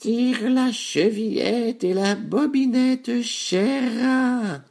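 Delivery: very high, slow, drawn-out granny voice; loud-edged, high-pitched old granny; grandmother. A narrator's reading. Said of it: "répondit la grand-mère, qui était trop faible".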